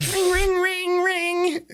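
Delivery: high, loud ring ring ring; loud sing-song doorbell mimic; doorbell imitation. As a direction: chime it out like the doorbell going off. Imitating doorbell waking him.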